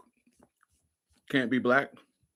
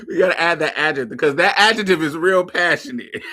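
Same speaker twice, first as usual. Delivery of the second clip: lively, talking out of a big laugh; 3 semitones higher, 5 dB louder than usual. Real, not acted. Host teasing caller about the adjective, laughing. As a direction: push the words out amid laughter.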